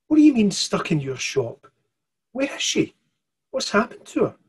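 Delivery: put-on a scared child demanding answers; frightened girl. Mirren asking the stranger about her mum, frightened.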